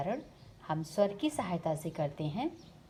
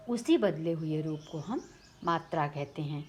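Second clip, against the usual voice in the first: ordinary talk, nothing marked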